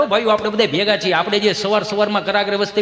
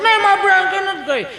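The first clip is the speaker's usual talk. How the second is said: very high, bratty refusal; mock child tantrum; whining child; 11 semitones higher than usual. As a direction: protest like a stubborn little kid. Acts child refusing food.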